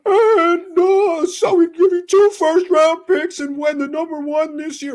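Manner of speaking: high, slow put-on voice; Trent Baalke impression. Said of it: "and in that voice, he's like".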